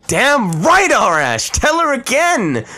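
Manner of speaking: high, loud fan cheer. Real, not acted. Streamer cheering the archer's speech, high and loud. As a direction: egg him on at the top of your voice.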